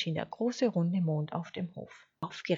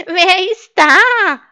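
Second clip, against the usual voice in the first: high, loud, drawn-out sheep bleat; bleating little voice; 13 semitones higher, 20 dB louder, much slower than usual. A narrator's reading. Sheep calling to an intruder in the stable, bleating. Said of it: "blökte ein feines Stimmchen".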